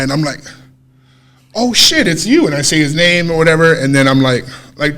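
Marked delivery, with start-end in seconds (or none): 1.5-2.5 high-pitched retold surprise; surprised recognition. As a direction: pitch leaps up on recognizing someone. Retold recognition of old schoolmate.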